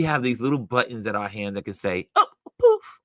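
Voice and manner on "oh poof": very high, loud mock magic poof; playful poof. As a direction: make a troll vanish with a flick. Mimicking the ban button.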